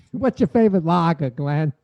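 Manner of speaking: put-on playful Boston accent on lager. Host teasing his friend about lagers, Boston accent. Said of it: "You do a Boston accent".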